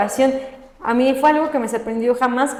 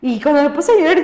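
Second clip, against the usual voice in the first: normal talking voice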